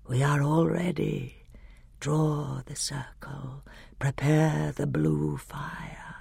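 soft, slow, sinking to a whisper; grey, terrible voice. A narrator's reading. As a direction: intone it like a quiet dark ritual. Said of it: "said the grey voice".

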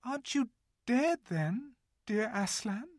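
put-on high, hesitant little girl; timid girl voice. A narrator's reading. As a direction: ask him gently, unsure. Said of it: "said Lucy".

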